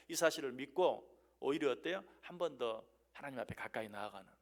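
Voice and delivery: ordinary talk, nothing marked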